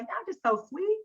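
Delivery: delighted gush breaking into a laugh. Retold or imitated. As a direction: coo it like you can't get over how cute they are. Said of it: "I'm like".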